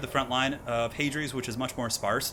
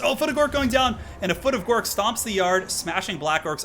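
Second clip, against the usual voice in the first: much higher and louder hype call; excited outburst; 9 semitones higher, 5 dB louder than usual. Real, not acted. Caster reacting as Foot of Gork stomps, excited shout. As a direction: shout it as the giant foot lands.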